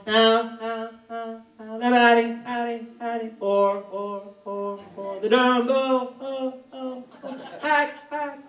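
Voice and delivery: PA announcer with echo done by mouth; fake stadium echo. Ballpark announcer faking stadium echo with his voice. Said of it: "creating echo".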